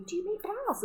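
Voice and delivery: very high-pitched mock question; imitated lady customer. Lady asking the no bra club founder, high mock voice.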